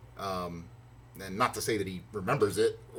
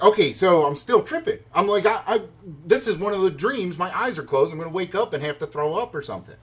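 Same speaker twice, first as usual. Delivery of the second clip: higher, louder, worked-up insistence; disbelieving self-talk; 5 semitones higher, 4 dB louder than usual. Retold or imitated. Traveler convinced he is dreaming, disbelieving self-talk. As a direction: talk yourself through an unreal moment.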